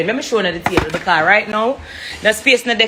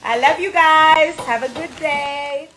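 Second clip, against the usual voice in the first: high, slow, beaming goodbye; cheerful sign-off; 8 semitones higher, slower than usual. Real, not acted. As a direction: wave the viewers off with joy. Sign-off greeting to viewers.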